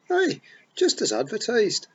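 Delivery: high, loud Scots pixie, full of pride; excited Feagle boast. A narrator's reading. Rob boasts to Tiffany about being born here, loud and proud. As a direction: wee warrior proudly telling his tale.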